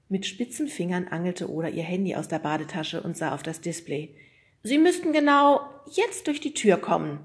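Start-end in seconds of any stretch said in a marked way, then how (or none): 4.6-7.2 lightly voiced Oda. Oda's voice in story.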